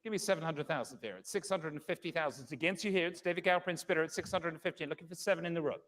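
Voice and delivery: brisk, measured bid calling; fine art auctioneer. Acted. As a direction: call the bids steadily, room to room.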